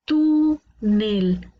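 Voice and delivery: "túnel" with a long TÚ, a short break, then NEL; stressed syllable shown. Shown as a demonstration. Teacher hunting the tonic syllable, "túnel" split and stretched. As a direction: hold TÚ of "túnel" on a level note, drop on nel. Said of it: "Vamos a encontrar su sílaba tónica".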